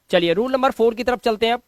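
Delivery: ordinary talk, nothing marked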